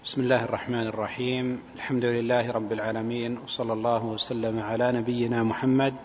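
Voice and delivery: ordinary talk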